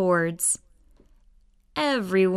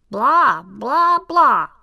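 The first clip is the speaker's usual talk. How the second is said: much louder, rising alien nonsense; alien babble; 3 semitones higher, 7 dB louder than usual. Acted. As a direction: blurt out loud alien gibberish. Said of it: "They went".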